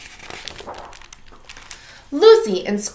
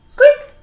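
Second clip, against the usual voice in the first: tiny critter squeak, much higher and louder; 10 dB louder than usual. Acted. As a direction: squeak like a little animal. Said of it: "And he said".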